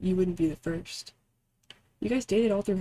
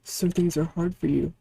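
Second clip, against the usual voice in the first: ordinary talk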